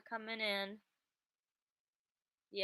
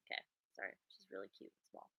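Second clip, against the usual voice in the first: whispering; 9 semitones lower, 9 dB quieter, faster than usual. Real, not acted. Quiet so cat keeps sleeping.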